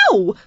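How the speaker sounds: a sharp, high yelp; yelp of pain. A narrator's reading. Lily yelps as the earl prods her ankle, sharp and high. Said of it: "Lily yelped in pain".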